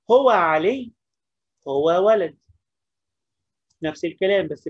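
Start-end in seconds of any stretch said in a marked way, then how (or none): 0.1-2.4 slow, loud dictation of طب هو علي. Shown as a demonstration. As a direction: spell it out slowly for the class. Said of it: "dictating slowly".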